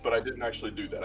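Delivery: normal talking voice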